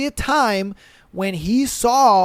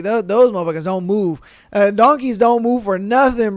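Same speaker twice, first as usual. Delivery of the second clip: ordinary talk, nothing marked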